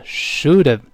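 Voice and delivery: "should've" run together as "shoulda-v", no h; linked reading. Shown as a demonstration. Teacher showing how the two words join, "should've" linked. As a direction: link "should've" into one word with a weak ending. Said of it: "跟have弱化之后那个of连读成".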